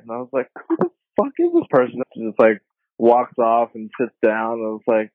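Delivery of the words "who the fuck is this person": high, squeaky retold shock; mock disbelief. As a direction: yelp it in stunned disbelief. Retelling his shocked reaction.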